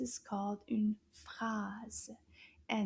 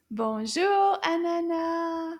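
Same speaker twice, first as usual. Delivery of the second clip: "Bonjour, Anana" called out louder; nice loud voice; 5 semitones higher, 7 dB louder, slower than usual. Shown as a demonstration. Teacher cheering kids to greet the puppet, loud call. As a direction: belt it out so the puppet hears. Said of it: "in a nice loud voice".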